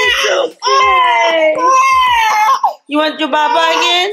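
high, loud comforting; loud soothing over crying. Real, not acted. Soothing screaming baby over crying.

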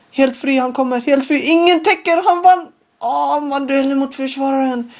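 alarmed excitement. Real, not acted. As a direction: panic as they break. Opponent breaking through.

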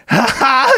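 high, scornful imitated ha ha ha; imitated high mocking laugh of the older brother; imitating brother's mocking laugh. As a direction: mimic a big brother laughing off a dream. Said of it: "He did the same laugh".

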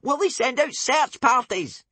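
put-on high worried boy voice; anxious Bodkin asking; nervous boy. A narrator's reading. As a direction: fret about rescue.

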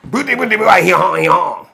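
put-on French accent. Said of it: "Like Pepe Le Pew".

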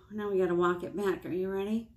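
ordinary talk

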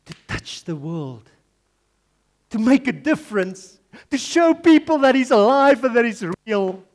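impassioned preaching, swelling to a high, loud peak. Real, not acted. Preacher tells believers God can use them, preaching forcefully. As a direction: build to a ringing declaration.

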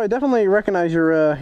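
ordinary delivery

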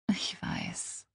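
hushed, whispered reassurance; sanft. Acted. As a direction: whisper it to comfort a crying friend. Freundin tröstet leise. (leise und verständnisvoll geflüstert)

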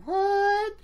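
very high drawn-out "what"; high, bewildered yelp; incredulous what. Acted. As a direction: squeak it in hammed-up disbelief. Group stares in disbelief.

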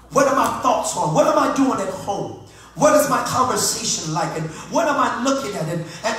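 rhythmic sermon crescendo; impassioned self-questioning preaching. Acted. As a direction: fire off searching questions with mounting urgency.

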